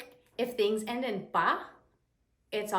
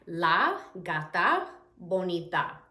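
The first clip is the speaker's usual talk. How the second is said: "La gata bonita" slowly, word by word; slow, carefully spaced Spanish example; slow teaching repeat; 2 semitones lower, 3 dB louder, slower than usual. Shown as a demonstration. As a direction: separate La, gata and bonita for learners.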